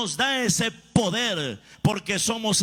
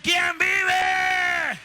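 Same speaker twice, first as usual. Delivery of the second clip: a preacher's battle cry, much higher and drawn out; preaching shout; 9 semitones higher, much slower than usual. Real, not acted. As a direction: shout it out to the whole church. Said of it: "asks congregation tonight".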